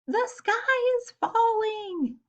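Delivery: high, slow frightened chick voice; dawning panic, high and drawn out; little chick frightened to bits; scared thought. Acted. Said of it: "he thought".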